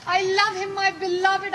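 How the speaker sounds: ordinary talk, nothing marked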